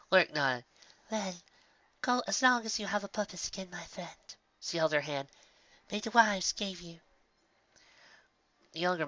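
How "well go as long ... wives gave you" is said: gentle blessing. A narrator's reading. Lyric blessing.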